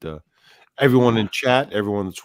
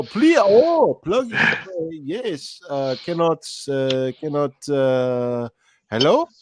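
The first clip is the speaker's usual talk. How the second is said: high, halting caricature voice; hammed-up confused caller fumbling for words; Pedro; 6 semitones higher, slower than usual. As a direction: put on a comic high-pitched accent. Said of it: "That was Pedro".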